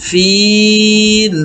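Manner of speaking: ফিল with the vowel held three alifs long; long vowel stretch. Shown as a demonstration. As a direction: draw out ফিল slowly. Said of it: "ফিল এখানে তিন আলিফ টান দিতে হবে". (ফিল তিন আলিফ টেনে)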